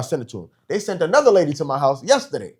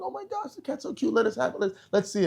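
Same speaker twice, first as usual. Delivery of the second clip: mock sweet squeal, much higher and softer; gushing clerk; 6 semitones higher, 8 dB quieter than usual. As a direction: coo over the cat like an excited clerk. Clerk gushing over cute cat.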